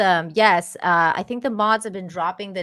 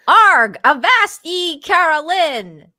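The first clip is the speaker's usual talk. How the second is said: high, slow hammed-up pirate drawl; 8 semitones higher, 9 dB louder, much slower than usual. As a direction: ham it up like a pirate reading aloud.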